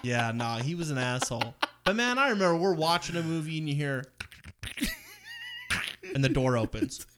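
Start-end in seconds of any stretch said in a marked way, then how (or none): none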